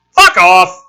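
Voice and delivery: loud angry yell; shout. Acted. Stoner yells at trick-or-treaters. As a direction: bark it through the door. Voice behind the door.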